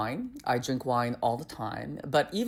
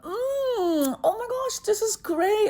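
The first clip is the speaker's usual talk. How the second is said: much higher, gushing mock rapture; fake delight; slower than usual. Tasting wine for the server, much higher gushing fake praise. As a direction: oversell the taste to please him.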